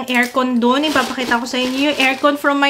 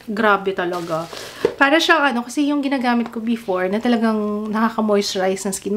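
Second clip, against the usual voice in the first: ordinary talk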